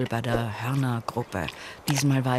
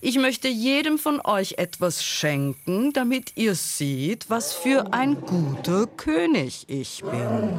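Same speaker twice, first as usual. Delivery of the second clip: high, grand royal announcement; lofty, high-pitched proclamation; proclaiming lion king; 8 semitones higher than usual. A narrator's reading. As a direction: address the crowd as a self-declared good king. Lion proclaims his generosity.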